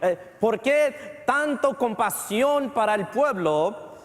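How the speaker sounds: rising pulpit fervor about the sacrifice; fervent preaching. Acted. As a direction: preach it with full conviction. Preaching at full force.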